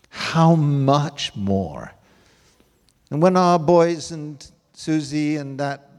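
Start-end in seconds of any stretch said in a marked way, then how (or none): 0.1-1.9 slow emphatic repeat. Real, not acted. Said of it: "repeated slowly".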